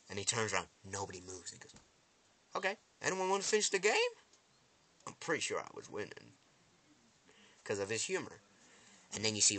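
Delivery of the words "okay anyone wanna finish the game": quick, high-pitched mock cheer; breezy taunt. Attacker asks stunned onlookers, breezy taunt. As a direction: toss it out fast and high.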